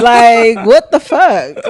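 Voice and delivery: usual speaking voice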